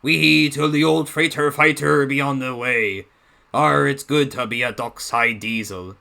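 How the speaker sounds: put-on slow, light pirate accent; pirate accent imitation. Fan imitates Baldwin's pirate voice for Salty. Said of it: "kinda nailed that pirate accent".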